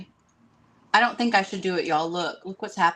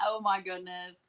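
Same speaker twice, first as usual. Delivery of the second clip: ordinary talk, nothing marked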